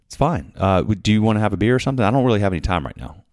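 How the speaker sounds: fast, high and loud, impatient; curt brush-off. Retold or imitated. Stressed brewery owner hurrying the visitor along, fast and curt. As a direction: rattle it off like you want them gone. Busy owner curtly offers a beer.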